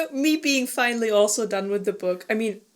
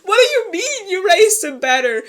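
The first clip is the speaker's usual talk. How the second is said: higher and louder, disbelieving; incredulous mock outrage; 8 semitones higher, 8 dB louder than usual. Friend retelling Freya's shocked reply, incredulous. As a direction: throw it back in disbelief. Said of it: "And she's like".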